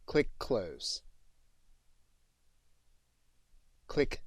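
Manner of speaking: clear, separate commands to the computer; plain, even command voice for the mic; dictating voice commands. Shown as a demonstration. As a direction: speak each command cleanly for the dictation software. Dictating commands to speech software.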